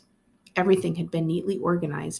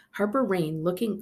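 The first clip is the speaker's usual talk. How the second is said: ordinary delivery, nothing marked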